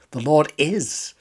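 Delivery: "is" drawn out and leaned on, slowly; stress on is. Shown as a demonstration. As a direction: land the weight on "is". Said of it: "Perhaps the word is".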